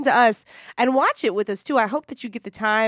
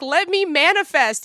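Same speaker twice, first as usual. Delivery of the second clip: mock pleading manifest; 6 semitones higher, 3 dB louder than usual. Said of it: "I'm just like".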